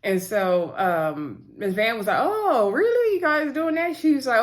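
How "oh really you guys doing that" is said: high-pitched "oh, really?" act; mock surprise. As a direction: imitate a mother surprised and probing, pitch jumping up. Retold mother's probing reaction.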